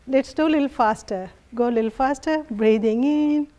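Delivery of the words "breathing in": normal talking voice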